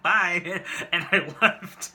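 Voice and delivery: loud laughing bye, slowed. Real, not acted. Telling the story of fleeing the proposal, laughing loudly. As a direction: laugh through it as you bolt.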